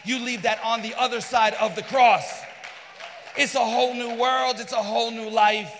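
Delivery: loud, rousing preacher's call; preaching shout. Real, not acted. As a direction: lift the room with a shout of hope.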